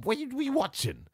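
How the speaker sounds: high-pitched disbelief; incredulous. Real, not acted. Podcaster rebutting critics of Adam Page's title run, incredulous. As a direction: question them in high, baffled protest.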